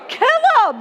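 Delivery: half-laughing "kill him" prayer; laughing mock prayer. Preacher voicing David's angry prayer with a laugh. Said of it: "That's really what he's saying here".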